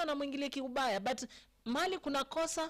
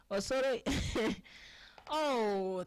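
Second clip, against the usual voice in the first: ordinary talk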